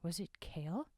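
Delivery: ordinary delivery, nothing marked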